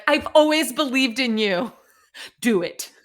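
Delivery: a hint of drill-sergeant push. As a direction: encourage like a stern but caring drill sergeant. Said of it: "She'd be like a drill sergeant".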